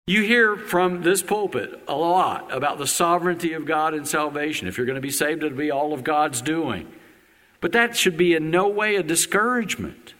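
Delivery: ordinary talk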